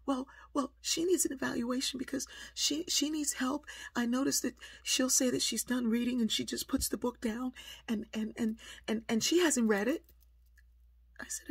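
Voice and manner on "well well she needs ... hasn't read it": high-pitched, stammering justification; agitated excuses tumbling out, pitched way up; frazzled teacher. Retold or imitated. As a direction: imitate a flustered teacher defending herself. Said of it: "she's all frazzled".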